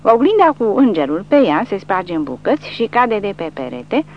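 plain reading voice

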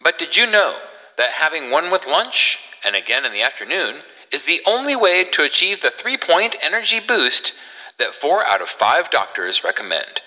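fast, upbeat pitchman patter; chipper 1950s ad. Acted. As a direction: rattle off the sales pitch. Host performs retro soda ad.

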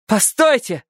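cry out. A narrator's reading. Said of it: "вдруг вскрикнула Эллисон".

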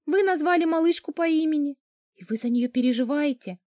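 high-pitched, mildly dazed disbelief; stunned question. A narrator's reading. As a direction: ask as if taken aback. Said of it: "ошалело спросила я".

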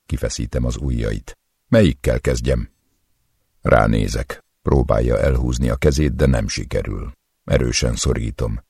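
ordinary delivery, nothing marked

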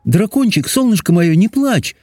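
high, tender princess soothing; sweet high-pitched coaxing; consoling princess. A narrator's reading. Princess consoles the sobbing dragon.

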